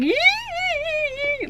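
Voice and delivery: very high, thin squealed giggle; imitated high-pitched laugh. Showing how high the manager laughed, imitated squealing giggle. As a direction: push a mock laugh as high as it goes. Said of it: "it really was like".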